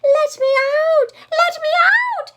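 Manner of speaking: high, loud, panicked squeal. Acted. Pig trapped in the cooking pot, panicked high squeal. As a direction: squeal to be let out. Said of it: "he squealed from inside the pot".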